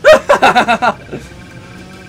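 scared laughing out loud; high loud frightened laughter; loud nervous laugh. Real, not acted.

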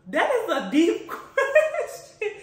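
high, loud and slow, laughing through it; stretched-out laughing marvel. Real, not acted. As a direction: laugh out the words in disbelief. Stunned by a deep question.

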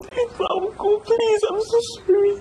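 high, sobbing pleas; crying plea. Acted. Woman begging the husband to forgive her, crying. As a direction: beg for forgiveness in tears.

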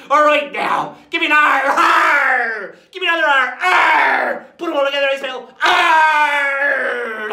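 high, full-voiced shouted chant; pirate cheer. Pirate leads listeners spelling R, roaring cheer.

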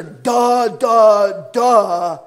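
loud put-on duh of a dummy; a dopey self-mocking duh. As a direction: slap your forehead out loud. Repeating the realization.

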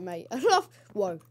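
ordinary talk

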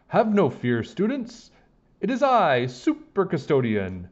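high, loud heroic announcement; superhero custodian. Acted. Custodian announcing herself to the students, heroic boast. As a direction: proclaim yourself a superhero. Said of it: "said Miss Lazar".

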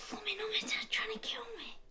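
hushed, rushed whispered grumble; a whispered complaint about dad. Real, not acted. Night-time whispered complaint.

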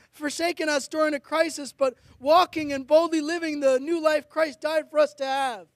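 ordinary delivery

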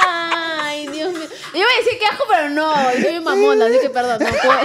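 scandalised giggles; laughing. Real, not acted. Reacción escandalizada riendo. (escandalizada y riendo, más aguda)

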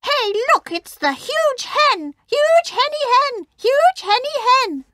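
very high, mocking hen voice; high-pitched jeering chant of hens; cackling hens. A narrator's reading. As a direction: tease in a squeaky hen cackle.

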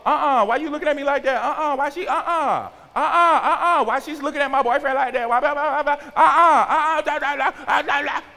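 put-on high, quick, yapping jealous-girlfriend parody; hammed-up teen fussing, tumbling into blah-blah; mock indignant teenage girl. Teen at the game over her boyfriend, mock indignant yapping. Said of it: "not how you go into the basketball game and go up".

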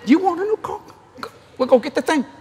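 high and bright, pushing it; eager offer. Retold or imitated. Husband urges wife to buy new car, eager. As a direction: jump at the chance to buy. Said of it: "I said".

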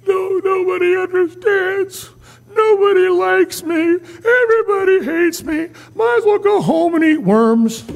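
high, slow self-pitying whine; whiny pity party. Acted. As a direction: whimper like a sulking child nobody loves. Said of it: "how many's ever had a pity party".

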